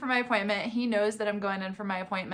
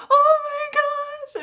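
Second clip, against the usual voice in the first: much higher, louder, slow, near tears; tearful oh my gosh; 8 dB louder, much slower than usual. Real, not acted. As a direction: break up with joy. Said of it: "starting to tear up".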